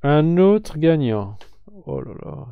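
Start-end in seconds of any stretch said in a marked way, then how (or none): 0.0-1.4 louder announcer call; loud announcement. Real, not acted. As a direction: punch it out louder, like announcing a prize. Streamer announces next draw loudly.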